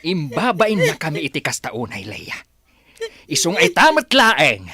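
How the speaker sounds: loud, furious banishment; angry shout. Acted. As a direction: drive her away in rage. Father banishes daughter. (nakapungtot nga ikkis a mangpapanaw)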